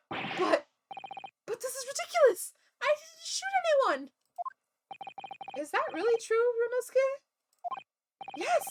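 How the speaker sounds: put-on high, pleading young man voice; panicked defendant. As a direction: protest your innocence, rattled. Defendant protests innocence, high voice.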